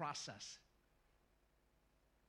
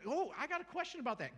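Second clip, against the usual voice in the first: eager question; 8 semitones higher, 5 dB louder than usual. Acted. Curious hearer.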